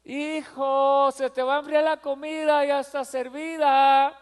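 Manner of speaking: patient mother calling. Retold or imitated. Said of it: "Mamá sigue diciendo".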